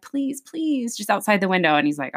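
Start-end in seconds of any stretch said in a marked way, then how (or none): 0.0-1.0 pleading lady. Retold or imitated. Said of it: "She's like".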